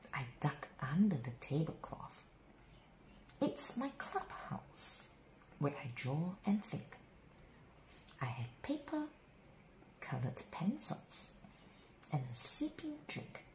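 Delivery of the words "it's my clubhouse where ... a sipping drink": secret whisper. A narrator's reading. Child in a tablecloth clubhouse, whispering. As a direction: share a secret hideout quietly.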